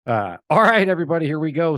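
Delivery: rally the audience to start; excited kickoff. Real, not acted. Host kicks off episode.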